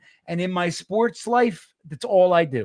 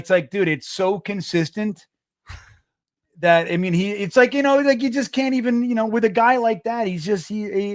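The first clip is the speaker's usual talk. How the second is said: ordinary talk, nothing marked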